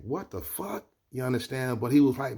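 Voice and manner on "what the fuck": high, hushed, baffled question; inner disbelief. Retold or imitated. Bookseller silently reacting to the stranger, high hushed disbelief. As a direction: think it aloud, softly and high-pitched. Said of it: "In my mind, I'm like".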